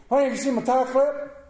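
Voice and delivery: high, loud call for honey; calling to wife. Retold or imitated. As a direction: call out to the wife, a little pleading. Preacher voicing himself asking wife.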